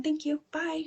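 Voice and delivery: high, soft little goodbye; breezy sign-off. Retold or imitated. Walking past staff as if she belongs, breezy and soft. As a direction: slip away on a light, high thanks.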